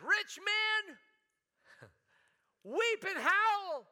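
high, loud, slow wail of weep and howl; wailing prophet. Retold or imitated. Preacher voicing James wailing at rich men. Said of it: "It means to shriek".

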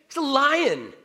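high, loud cry of the obvious; emphatic exclamation. Retold or imitated. Emphatic answer to Narnia question.

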